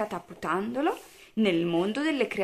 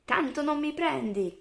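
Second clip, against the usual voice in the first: high, teasing singsong; playful high-pitched chant; singsong taunt; 8 semitones higher than usual. A narrator's reading. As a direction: tease like a little one in a chase. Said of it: "canticchiava Teddy".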